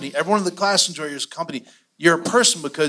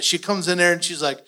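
ordinary talk, nothing marked

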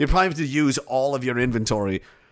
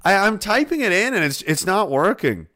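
higher-pitched mock struggle; feigned helpless caller; 6 semitones higher, 3 dB louder than usual. Caller wasting a scammer's time, put-on helpless voice. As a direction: play dumb to string them along. Acting himself stringing the scammer along.

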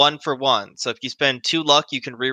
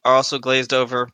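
ordinary talk, nothing marked

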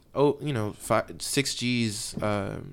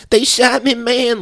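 high, loud hammed-up wail of "they shot me"; mocking a suspect crying he's been shot; whiny shot suspect; 14 semitones higher, 9 dB louder, slower than usual. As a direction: play the overdone victim, whining loud and high. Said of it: "Like a little, like, possum".

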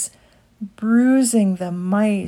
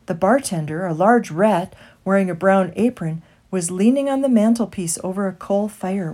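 usual speaking voice

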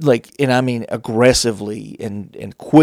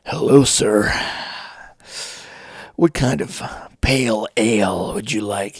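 fake sensual; 2 semitones lower, 3 dB quieter than usual. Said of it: "that fake sensual kind of".

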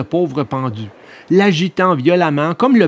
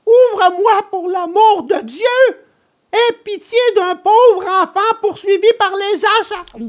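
very high, imploring puppet voice; desperate cry; 4 dB louder than usual. A narrator's reading. Puppet begging for pity, pursued by assassins, crying out desperately. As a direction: beg for your life at the top of the register. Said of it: "criait Pinocchio".

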